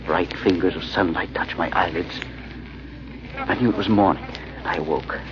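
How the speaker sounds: hushed, unhurried storytelling; story narration. A narrator's reading. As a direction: draw the listener into the dream. Narrator tells his dream.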